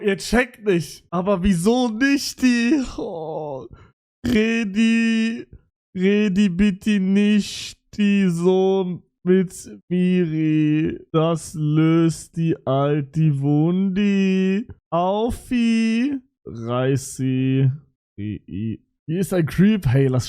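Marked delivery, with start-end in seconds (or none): 4.2-16.2 every word ends in -i, slow and drawn out, "Redi", "Wundi", "Aufi"; mock I-language. Streamer imitating a girl who talked in I-language. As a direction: imitate her babyish talk adding -i to words. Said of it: "in I-Sprache geredet".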